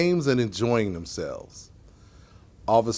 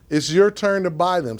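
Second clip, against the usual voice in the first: higher, lightly teasing line; playful storybook dialogue, pitched up; said with a grin; 6 semitones higher than usual. A narrator's reading. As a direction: say it through a grin. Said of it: "says Officer Thea with a grin".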